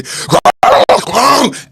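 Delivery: hammed-up warthog growl; imitated growl. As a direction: growl like the wild boar. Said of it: "You hear the boy say".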